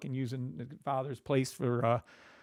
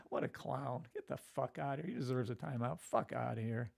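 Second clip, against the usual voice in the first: dismissive mutter; 3 semitones lower, 10 dB quieter than usual. Real, not acted. Dismissive mutter after rant.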